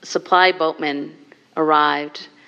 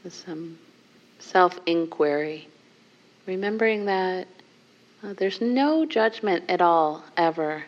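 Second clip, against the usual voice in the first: guided meditation; 2 semitones lower, 4 dB quieter than usual. Acted. Teacher guiding the group into a seated contemplation, softly. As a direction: soften and guide them gently inward.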